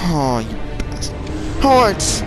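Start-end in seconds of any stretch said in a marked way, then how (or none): 1.6-2.2 high, loud exasperated yell; frustrated yell. Real, not acted. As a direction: yell out in disbelief. Reacting to dying again.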